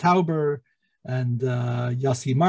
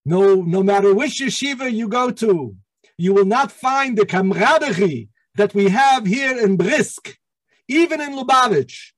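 put-on older rabbi with a thick European accent, pitched higher and louder; warm, higher impression of a thick European accent; Rabbi Soloveitchik's thick European accent; 5 semitones higher, 4 dB louder than usual. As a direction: imitate an old Eastern European rabbi's accent, fondly. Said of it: "in his thick European accent".

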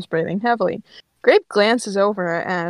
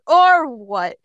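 raised, loud dare; taunting challenge; 5 semitones higher, 6 dB louder than usual. Acted. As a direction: throw the threat back as a challenge. Grape challenges Ember.

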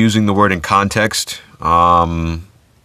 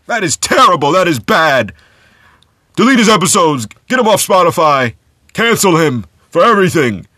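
much higher, whiny mock outrage; mock outraged critics; 9 semitones higher than usual. Podcaster mimicking Twitter critics demanding cancellation, high mock outrage. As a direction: parody shrill online cancelers. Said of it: "some people were like".

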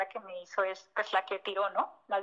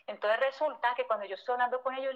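ordinary talk, nothing marked

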